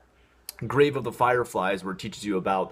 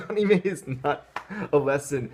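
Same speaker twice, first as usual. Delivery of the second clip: higher, laughing mock reading of the claim; mocking repeat; 6 semitones higher than usual. Shown as a demonstration. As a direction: parrot the line back with a scoffing laugh. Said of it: "yeah, no kidding".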